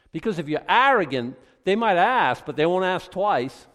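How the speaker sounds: loud, high preaching burst; rising sermon shout. Acted. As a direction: hammer the warning home. Preaching about arrogance.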